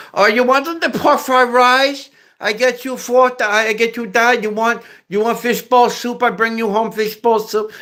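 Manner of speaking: very high-pitched mock Chinese accent; bastardized Mandarin-style accent, high throughout; caricature Chinese accent. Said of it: "that's Chinese".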